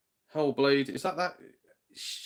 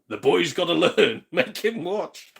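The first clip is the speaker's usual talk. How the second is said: louder, higher, slow mock-stern parent; 7 semitones higher, 9 dB louder, slower than usual. Parents refusing to send the boy to bed, mock stern. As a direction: ham up dad insisting the boy keeps watching. Mum and dad voiced, joking.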